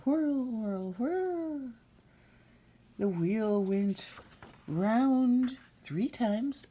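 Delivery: high, slow drawn-out whirl; spinning whirl. Acted. Narrator voicing the wheel spinning, high and slow. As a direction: stretch it out like the wheel turning.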